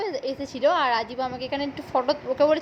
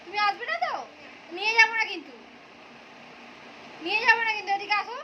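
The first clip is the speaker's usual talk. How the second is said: playful threat; 7 semitones higher, faster than usual. Pretend. Teasing threat to toddler.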